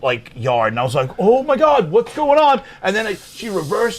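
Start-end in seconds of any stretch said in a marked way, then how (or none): 1.2-2.6 alarmed. Retold or imitated. Witnessing a car crash.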